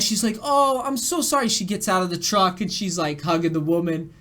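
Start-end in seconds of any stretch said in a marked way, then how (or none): 0.4-1.5 high, sympathetic cooing; very nice mom. Retold or imitated. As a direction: gush sympathy like a kind mother. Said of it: "like, being so nice".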